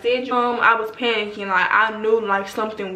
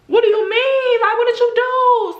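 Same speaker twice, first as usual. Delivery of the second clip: high-pitched, raised-voice alarmed questioning; alarmed parent; 13 semitones higher, 4 dB louder than usual. Retold or imitated. Acting out a parent hearing their baby panic, alarmed. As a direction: demand answers, voice shooting up in alarm.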